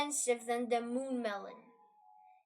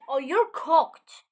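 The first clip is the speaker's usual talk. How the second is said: mock doom; 3 semitones higher, 11 dB louder, slower than usual. Mock doom over ruined plant.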